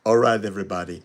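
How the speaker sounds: hammy attempt at an Arnold accent. Shown as a demonstration. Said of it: "I can't do an Arnold accent, but I'll try".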